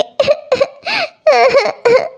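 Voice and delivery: wet sobbing breaths; sobbing cry of a wife who lost money; tearful sob. Acted. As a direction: sob helplessly. Crying over lost money.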